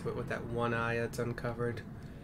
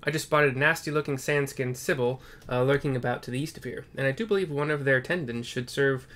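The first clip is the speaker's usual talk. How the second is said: a cheerful quest-giver, louder at the start; friendly NPC voice; 4 dB louder than usual. Acted. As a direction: greet the hero as a grateful friend.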